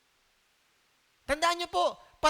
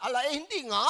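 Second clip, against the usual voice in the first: laughing denial in a put-on Batangas accent. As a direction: deny it in a Batangas accent with a chuckle. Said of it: "yung tono niya, taga Galilea".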